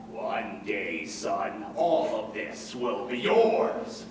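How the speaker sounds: low slow booming father voice; solemn low fatherly pronouncement; imitating grand father. Lion quoting his father on the mountain, low and grand. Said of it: "and he said".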